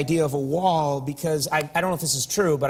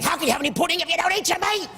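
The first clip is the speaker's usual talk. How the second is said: high, fast shrieking schoolmaster voice; mock yelling teacher; 13 semitones higher, faster than usual. Host yelling the famous line at strangers, high and fast. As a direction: screech it like a bossy teacher. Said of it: "without suddenly yelling".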